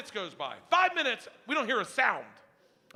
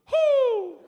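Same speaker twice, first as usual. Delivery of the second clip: high, loud owl hoot; 10 dB louder than usual. Retold or imitated.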